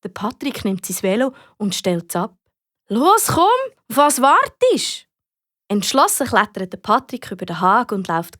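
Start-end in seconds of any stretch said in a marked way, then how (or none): 2.9-5.1 higher, drawn-out impatient call; impatient urging. Acted. Patrick hurrying Amy over to the fire, impatient. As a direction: urge them to get moving.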